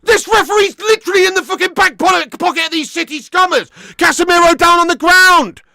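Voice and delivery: loud, high, raging yell at the referee; furious shout at the ref. Real, not acted. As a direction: scream your outrage at a bent ref. Fan raging at referee on stream.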